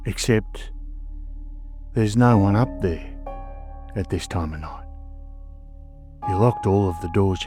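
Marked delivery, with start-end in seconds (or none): none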